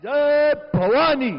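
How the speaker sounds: high, loud, slow chant; roaring rallying speech. Real, not acted. Speaker repeats slogan. (ऊँचा गरजता जयघोष)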